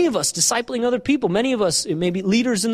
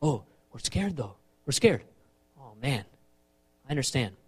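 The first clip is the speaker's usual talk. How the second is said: hushed, low, gentle voices; quiet acted concern; acted sympathetic exchange; 8 semitones lower, 11 dB quieter than usual. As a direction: drop soft and low to commiserate. Acted scared men, understanding David.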